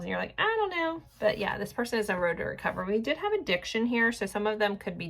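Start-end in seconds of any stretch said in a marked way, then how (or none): 0.4-1.0 high-pitched, unconvinced reply; mock a sceptic, pitch jumping up high; doubtful listener.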